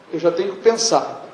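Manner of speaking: usual speaking voice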